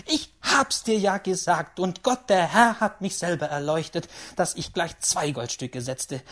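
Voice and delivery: proud, emphatic exclaiming, settling down; pleased great-aunt, loud at first; triumphant old lady. A narrator's reading. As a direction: boast with beaming satisfaction. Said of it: "rief die alte Dame strahlend und zufrieden".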